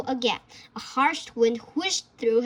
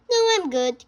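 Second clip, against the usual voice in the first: put-on light, higher boy's voice; 5 semitones higher, 5 dB louder, faster than usual. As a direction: voice a polite young boy. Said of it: "Carter said".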